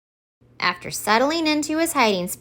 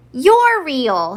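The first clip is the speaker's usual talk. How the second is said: higher and louder, thrilled; excited shout; 6 semitones higher, 6 dB louder than usual. Acted. Harold greeting Santa at last, excited shout. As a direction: shout it with delight. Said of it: "he shouted".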